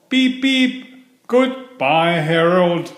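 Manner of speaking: high cheeky shout, beep beep. A narrator's reading. Percy shouting goodbye to Harold while overtaking, high cheeky call. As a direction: toot and holler as you race past. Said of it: "he shouted as he raced ahead".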